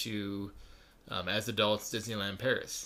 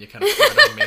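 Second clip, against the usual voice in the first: laughing; 17 dB louder than usual. Real, not acted. Laughing mid-sentence.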